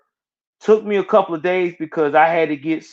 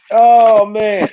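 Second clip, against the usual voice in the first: amused outburst; 4 semitones higher, 6 dB louder than usual. Real, not acted. Laughing at dog in the shot.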